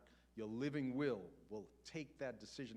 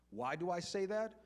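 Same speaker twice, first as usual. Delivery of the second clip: normal talking voice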